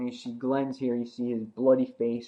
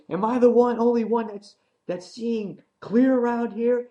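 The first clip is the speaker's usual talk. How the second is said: much higher, louder indignant rant; ranting Nicholas; 12 semitones higher, 5 dB louder than usual. Retold or imitated. Nicholas ranting at the group, much higher. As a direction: demand an answer from the group. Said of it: "He goes".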